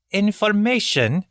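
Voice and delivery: high, sharp, slow questioning; sharp repeat. A narrator's reading. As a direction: snap the word back as a question. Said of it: "sharply repeated".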